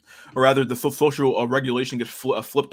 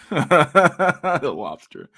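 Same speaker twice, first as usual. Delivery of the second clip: 1 laughing; 5 semitones higher, 5 dB louder, much slower than usual. Real, not acted. Laughing callback to mock voice.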